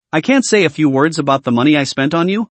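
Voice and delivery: plain reading voice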